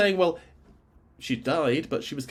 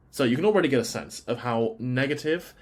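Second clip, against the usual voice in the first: ordinary delivery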